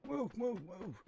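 high urgent move calls. Real, not acted. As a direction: hurry them along, pitch up. Urging teammates to move.

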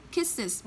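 "kisses" drawn out slowly, KISS-iz. Shown as a demonstration. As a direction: stretch "kisses" so each syllable lands. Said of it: "Thì cô sẽ đọc nó là".

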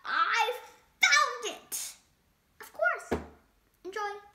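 lively picture-book read-aloud; animated storytime reading; reading to children. A narrator's reading. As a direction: read it to a room of kids.

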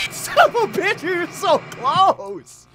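very high, loud, drawn-out shriek; anguished scream. Real, not acted. Player so close to six A M, screaming in frustration.

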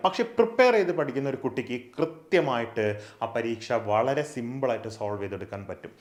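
usual speaking voice

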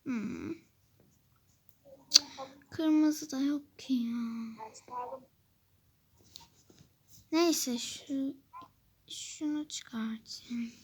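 whispered to herself; whispering. Real, not acted. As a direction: whisper it under your breath. Quiet muttering to self.

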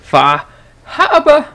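loud, high, drawn-out hammed-up Bostonian shout; Boston accent. Shown as a demonstration. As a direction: belt it out in a Boston accent, r's dropped. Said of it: "like a Bostonian".

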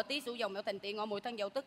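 low, hushed zodiac recitation; fortune-teller's muttered count. Acted. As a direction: reel off the signs softly under your breath.

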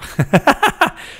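high burst of laughter. Real, not acted. Commentator amused by his own slang outburst, high laugh.

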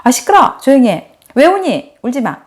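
high-pitched mock parental telling-off; scolding commands. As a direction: imitate an impatient adult ordering a child about. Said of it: "이렇게 어떤 명령과 제재만 당했지". (아이를 다그치는 높은 명령조)

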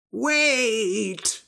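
high, drawn-out call; shouted wait. A narrator's reading. Priest about to be thrown in the river, calling out. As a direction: call out to stop them, acted lightly. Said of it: "shouted the priest".

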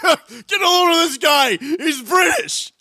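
high, slow, loud heckle; mock jeering shout. Player pointing out the British guy, loud drawn-out jeer. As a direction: jeer it out at the top of the voice.

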